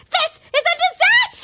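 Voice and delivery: high-pitched dramatic despair; frantic, rising panic; panicked outburst. Acted. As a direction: wail it as if all is lost. Panics over missing guest.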